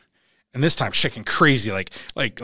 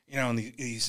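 usual speaking voice

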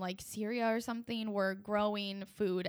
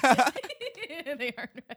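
laughing; 4 semitones higher, 9 dB louder, slower than usual. Real, not acted. Laughing repeat of own line.

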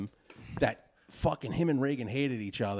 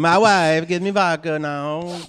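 put-on Russian accent; 3 semitones higher, 8 dB louder, slower than usual. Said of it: "Borat-y Russian dude".

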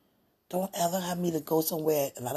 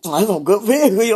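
tipsy loud quote; 11 dB louder than usual. Retold or imitated. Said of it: "I was like".

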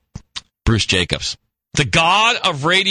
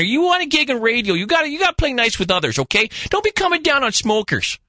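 much higher mock voice of a radio colleague; imitating Bruce, pitched way up; impersonating Bruce; 10 semitones higher than usual. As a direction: ham up the pushy coworker. Bruce voiced lecturing him.

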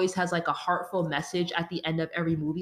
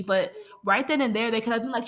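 normal talking voice

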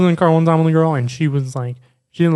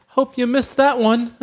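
put-on high, slow Australian girl's trash talk; Australian accent; 7 semitones higher, slower than usual. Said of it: "She was literally talking shit".

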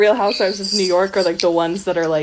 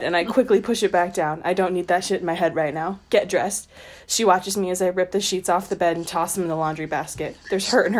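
steady read-aloud of a novel passage; story narration; 2 semitones lower, faster than usual. A narrator's reading. Co-host reads the book passage aloud, in measured narration. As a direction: read the chapter evenly to the listeners.

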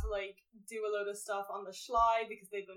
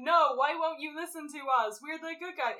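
protesting; 6 semitones higher, 5 dB louder than usual. Retold or imitated. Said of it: "and they're like".

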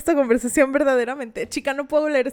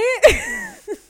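high cringing laugh. Real, not acted. Reacting to the Tampax line.

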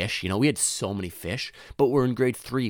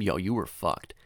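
ordinary talk, nothing marked

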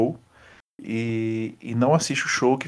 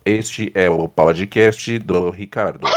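slow, lower robotic synth voice; Stephen Hawking voice; 5 semitones lower, slower than usual. Acted. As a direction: speak like a slow speech synthesizer, low and even. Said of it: "eu sei fazer a voz do Stephen Hawking".

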